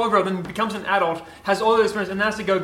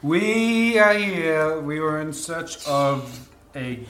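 slow solemn character voice; 5 semitones lower, 4 dB quieter, much slower than usual. Acted. Player speaks in character, slow.

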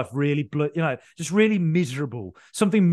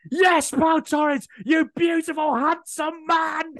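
much higher, slow, drawn-out cheer; swooning over the goalscorer; ecstatic celebration; 11 semitones higher, much slower than usual. Retold or imitated. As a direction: relive the goal like you can't contain yourself. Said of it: "I had no control of myself".

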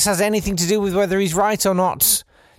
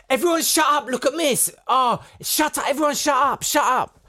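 put-on high, yelled hush-up from a class of kids; kids shouting shut up; 9 semitones higher than usual. Classmates hushing the room, high mock yell. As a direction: kids shouting each other down, pitched high. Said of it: "everyone started going".

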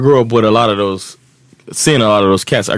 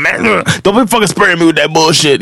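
higher, complaining street voice; annoyed crackhead; 7 semitones higher than usual. Retold or imitated. Kid sprays customer, who grumbles in a higher voice. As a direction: complain as you walk out.

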